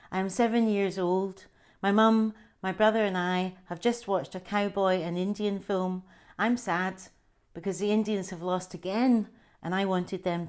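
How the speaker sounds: an author reading her memoir aloud; story narration. Acted. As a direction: tell the childhood scene as a gentle story reading. Reads her memoir extract aloud.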